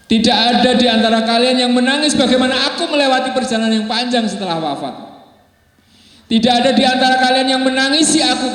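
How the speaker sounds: high, anguished lament, rising to a near cry; a dying man's tearful reproach; weeping dying man. Acted. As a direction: pour out the dying man's grief through tears. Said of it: "tak kuasa menahan tangisnya".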